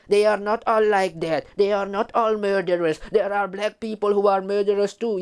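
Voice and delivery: a whiny impression of an apologist insisting not all are bad; mocking city maroon. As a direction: put on a pleading, defensive tone as the city maroon. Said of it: "city maroons who will come to people like me and say".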